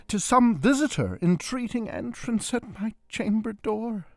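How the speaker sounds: high and loud, rushing the words; like a startled man talking himself into calm; agitated exclaim. Acted. Startled man repeating his guess at the door, high and loud. Narrator repeating a worried thought.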